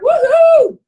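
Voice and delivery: very high, loud woohoo; real delighted cheer; excited whoop. Real, not acted. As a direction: cheer your friend into the room. Cheering a collaborator joining.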